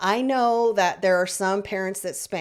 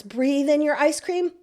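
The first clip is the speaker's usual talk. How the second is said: put-on high, louder sing-song voice for a small child; coaching a child to breathe; 7 semitones higher, 5 dB louder than usual. Shown as a demonstration. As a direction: coax the child through the breath like a game. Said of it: "Do that several times".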